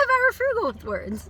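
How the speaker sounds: high, loud laughing line; amused burst of laughter. Real, not acted. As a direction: laugh as you say it. Laughs at own word choice.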